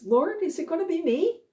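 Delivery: a worried disciple asking his master; anxious Judas. Retold or imitated.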